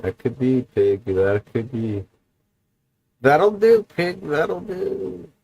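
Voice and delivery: a hint of the old farmer, gentle; farmer quote. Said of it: "Babe the Pig".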